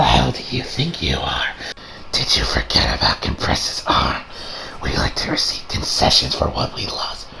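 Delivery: low, menacing whisper; whispered threat. Retold or imitated. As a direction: hiss it quietly like a cold villain. Quoted villain threat, whispered.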